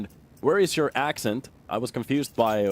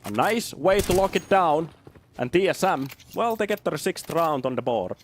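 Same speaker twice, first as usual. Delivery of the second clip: put-on thick Finnish-accented English, higher-pitched and lively; 6 semitones higher, 3 dB louder than usual. Casting a match to show how Finns sound, thick Finnish accent. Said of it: "that's what we sound like".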